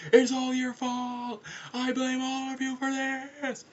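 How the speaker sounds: high, slow, drawn-out yell; wailing accusation. Acted. Wendy blaming the others during the nosedive, high wail. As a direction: wail the blame, stretching each word.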